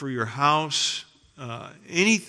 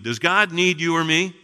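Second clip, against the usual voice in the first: raised, loud question with emphasis on "need"; stress on need; 5 semitones higher, 7 dB louder than usual. Shown as a demonstration. Preacher repeating his challenge to the church, stressing "need". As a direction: hit the word "need" as you challenge them. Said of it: "put emphasis on the word need".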